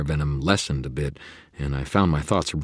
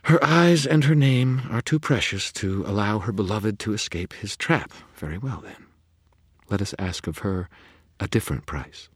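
higher, silky scheming fae; sly faerie godmother; 6 semitones higher than usual. A narrator's reading. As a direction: purr out the terms. Leah voiced by narrator.

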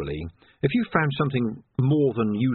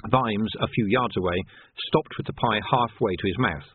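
plain reading voice

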